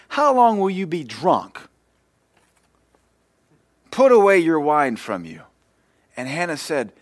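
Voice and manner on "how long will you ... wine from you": accusing priest. Acted. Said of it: "And he says".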